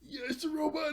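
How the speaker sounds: gasp, then jump up high in sudden wonder; amazed discovery. Retold or imitated. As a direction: imitate someone stunned to spot the robot.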